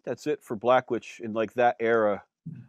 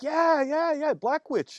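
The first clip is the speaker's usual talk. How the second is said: put-on French Canadian accent; 13 semitones higher, much slower than usual. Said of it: "French Canadian".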